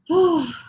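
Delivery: big exhale after the set; loud relieved sigh. Real, not acted. Just finished the knee-ins.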